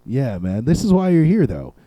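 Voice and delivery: ordinary talk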